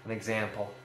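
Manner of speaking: normal talking voice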